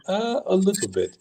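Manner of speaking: high-pitched, hesitant reply; high, tentative retold voice; hesitant soldier. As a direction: imitate a soldier unsure of his English, pitched well up. Soldier admits to limited English.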